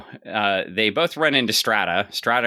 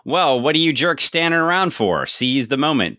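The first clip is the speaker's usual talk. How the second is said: gruff scolding; 6 semitones higher, 3 dB louder than usual. Retold or imitated. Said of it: "And basically".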